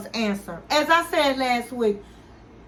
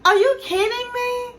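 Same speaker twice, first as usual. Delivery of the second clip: incredulous Gino; 11 semitones higher than usual. Retold or imitated. Gino stunned at being told not to come, hammed-up disbelief. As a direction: ham up his stunned face in the voice. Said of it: "Gino makes those faces".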